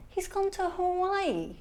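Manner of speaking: high, slow, disbelieving inner voice; a hushed, incredulous aside to yourself; stunned inner thought. Retold or imitated. Mother's inner thought on school run.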